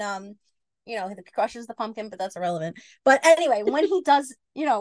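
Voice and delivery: ordinary talk, nothing marked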